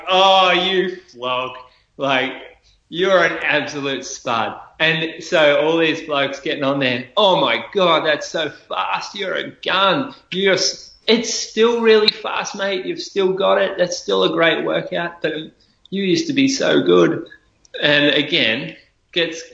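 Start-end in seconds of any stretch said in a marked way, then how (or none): 0.1-4.6 higher, drawn-out scoffing at a show-off; mock disbelief. Runner scoffing at a braggart's comment, higher mock disbelief. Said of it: "And I'm like". 7.2-17.3 higher, hammed-up gushing praise; mock fawning fan. Blokes praising a runner in comments, hammed-up higher gushing.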